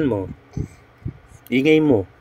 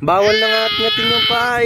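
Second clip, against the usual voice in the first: put-on much higher, teasing baby-talk voice; squeaky baby talk. Mother teasing her angry baby in baby talk.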